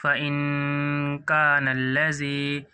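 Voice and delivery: very slow, nasal held; slow drawn reading. Shown as a demonstration. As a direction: draw out ان before کان. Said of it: "یہاں گننا ہوگا۔".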